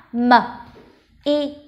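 usual speaking voice

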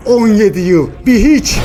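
heated, forceful contempt; angry dismissal. Acted. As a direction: throw it out loudly, pitch climbing. Father dismisses years with son.

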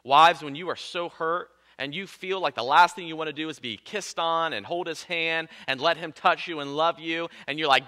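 ordinary delivery

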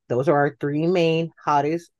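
usual speaking voice

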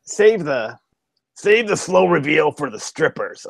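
put-on slow old codger growl of advice. Imitating the old professor's writing rule, slow codger voice. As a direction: deliver it like the grizzled old teacher. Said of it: "what he used to say to me".

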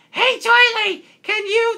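a half-hearted go at Lufa's voice; imitating Lufa. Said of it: "I'll do my Lufa impression".

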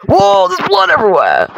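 high startled cry, falling at the end; alarmed exclamation. Real, not acted. Gamer sees blood all over the screen, alarmed exclamation.

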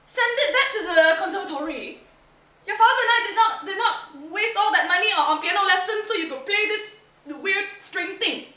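high, loud, fast scolding; angry mother. Acted. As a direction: scold your daughter in disbelief. Mother scolds about zither.

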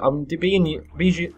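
tripping over the words, flustered; stuttering. Real, not acted. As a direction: stumble and restart each word. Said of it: "can't even speak".

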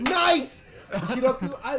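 high, loud burst of delight; excited cheer. Real, not acted. Streamer cheering a viewer's perfect comment, loud and excited. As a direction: cheer it out, thrilled. Good news arrives on stream.

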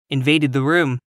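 usual speaking voice

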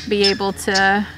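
ordinary delivery

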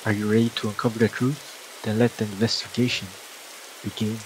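dramatic movie trailer voice; trailer narration. Acted. Game intro narration.

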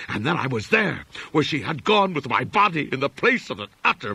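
high, loud, frantic raving, words tumbling out; frantic raving madman. A narrator's reading. Edward raving to his rescuer about the pit, frantic and high. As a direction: rave like a man who has seen hell. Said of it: "pouring out a meaningless, half-incoherent torrent".

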